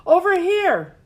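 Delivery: high, loud shout; calling out loud. A narrator's reading. Girl shouting to guide firefighters to her. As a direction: shout to guide them over. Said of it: "she called out again".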